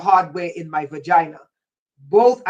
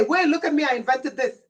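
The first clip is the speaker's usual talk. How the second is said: mocking boast; 6 semitones higher than usual. Mocking vampires who steal inventions.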